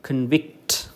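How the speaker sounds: convict as con-VICT; stress on second syllable. Shown as a demonstration. Teacher naming the stressed syllable, con-VICT for convict. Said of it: "second syllable".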